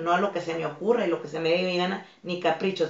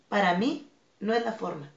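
normal talking voice

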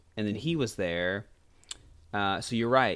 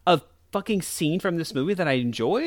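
mock amazement; 6 semitones higher than usual. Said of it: "I was like".

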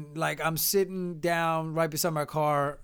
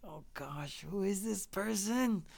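much softer, inward wondering; puzzled aside; 2 semitones higher, 9 dB quieter, slower than usual. Retold or imitated. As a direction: murmur your private dismay, slowing down. Puzzled inner thought.

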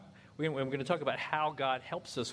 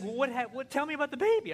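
much higher, louder wife voice; wife probing; 11 semitones higher, 4 dB louder, faster than usual. Retold or imitated. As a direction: press him for details. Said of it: "She's like".

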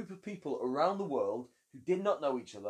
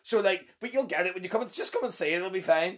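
higher, louder promo-style pitch; a hint of a cheesy show advert; mock playbill pitch; 3 semitones higher, 3 dB louder than usual. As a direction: sell it like a hammed-up theatre blurb. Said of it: "Uh, my playbill".

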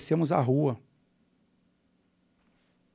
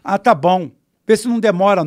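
louder, grudging consent with mild irritation; slightly irritated; 4 semitones higher, 7 dB louder than usual. Retold or imitated. Gunman lets the visitor stay briefly, slightly irritated. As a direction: concede it curtly, a bit annoyed. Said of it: "Falei com uma voz um pouco irritada".

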